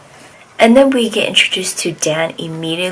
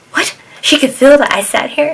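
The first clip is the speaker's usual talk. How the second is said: higher, astonished retelling; mock disbelief; 9 semitones higher, 4 dB louder than usual. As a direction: act out startled disbelief. Retold shock at being noticed.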